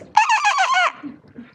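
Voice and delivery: high squeaky toy noises; Furby squeaking. Retold or imitated. Speaker mimics the Furby squeaking at Siri, high squeaks. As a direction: squeak like a broken talking toy. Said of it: "the Furby goes".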